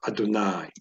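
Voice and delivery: "Adonai" stressed on the final NAI; a-do-NAI, weight on the end of "Adonai"; stress on last syllable. Shown as a demonstration. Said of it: "Stress is on the last syllable".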